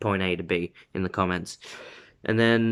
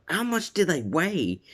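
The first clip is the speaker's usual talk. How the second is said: high-pitched mock amazement; amazed question; 8 semitones higher than usual. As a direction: imitate an astonished onlooker. Acting out amazed classmates' question.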